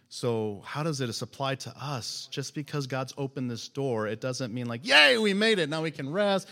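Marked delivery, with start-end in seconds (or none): 4.9-6.5 higher, louder burst on Yay, then easing; mock celebration. Believers celebrating too soon, mock relief and cheer. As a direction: cheer as if it's all over. Acted celebration at an opened door.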